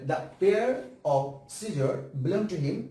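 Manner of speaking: very slow, word by word; slow dictation. Shown as a demonstration. Teacher dictating a sentence for the class to correct. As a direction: spell it out slowly for them to copy. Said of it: "দ্রুত লিখে দিই".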